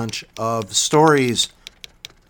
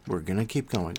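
softer repeat, dropped to nearly half volume; quiet echo; 4 semitones lower, 9 dB quieter than usual. Real, not acted. Host repeating his promise to listeners, quiet echo. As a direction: let it fall away like an echo to yourself.

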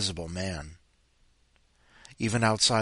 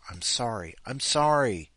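ordinary talk

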